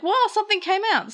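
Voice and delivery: high, fast, startled exclaim; startled character. Acted. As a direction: blurt it out, caught off guard. Pulsemon startled by liquid.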